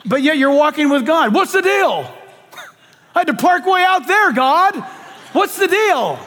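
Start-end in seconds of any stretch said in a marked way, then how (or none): 1.3-6.2 high-pitched, put-upon griping; mildly indignant protest, pitched up; mock complaint. Believer complains to God about a far parking spot, high mock indignation.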